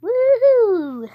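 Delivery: long, loud, high woohoo; excited whoop. Real, not acted. Player racing through a level, excited whoop. Whooping while speeding in a game.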